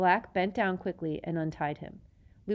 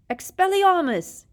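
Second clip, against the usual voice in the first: high, loud battle cry; yelling spell; 13 semitones higher, 11 dB louder than usual. A narrator's reading. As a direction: shout the spell. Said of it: "he yelled".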